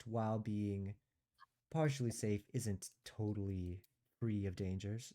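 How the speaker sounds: ordinary talk, nothing marked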